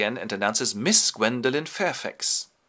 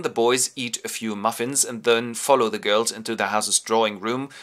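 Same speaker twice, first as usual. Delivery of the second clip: usual speaking voice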